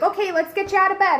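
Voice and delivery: high, loud bossy cheer; mocking a nurse's bright orders, loud and high; chirpy nurse.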